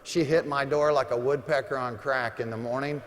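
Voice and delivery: ordinary talk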